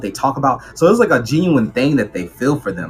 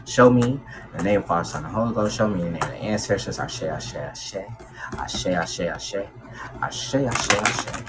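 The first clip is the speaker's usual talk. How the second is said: quiet invocation; 3 semitones lower, 7 dB quieter than usual. Real, not acted. Invoking ancestors in a prayer.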